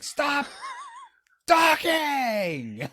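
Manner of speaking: very high, drawn-out scolding shout; mock furious yell. Pretending to scold a talker mid-movie, mock furious yell. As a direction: yell it as a comic outburst. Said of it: "rip it off of your head and be like".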